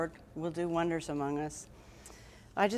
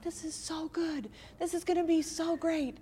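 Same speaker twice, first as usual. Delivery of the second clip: much higher, bright hopeful gush; giddy optimism; 10 semitones higher than usual. Retold or imitated. Parent imagining the homeschool plan, mock-excited gush. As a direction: gush over the grand plan. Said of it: "you're like".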